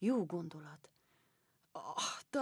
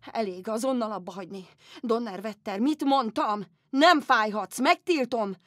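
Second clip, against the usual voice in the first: loud, furious scolding, getting louder; angry command; 2 semitones higher, 10 dB louder than usual. Acted. Empress shouting at her own aching foot, enraged. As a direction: order the pain to stop, in a fury.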